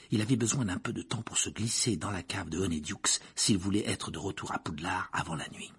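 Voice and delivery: ordinary delivery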